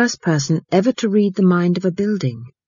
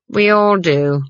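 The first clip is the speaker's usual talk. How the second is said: vague; 3 semitones higher, 3 dB louder, slower than usual. Acted. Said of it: "said Granny vaguely".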